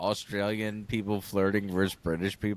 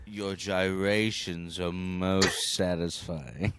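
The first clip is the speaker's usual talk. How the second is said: slow, lower-pitched mock British; mock British accent; 5 semitones lower, much slower than usual. Host offering another British line, slow mock British accent. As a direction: savor it in a put-on British voice. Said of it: "when British people say is like".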